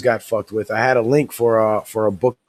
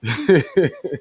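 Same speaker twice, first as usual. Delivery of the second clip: short laugh; 6 semitones higher than usual. Real, not acted. Laughs after own bit.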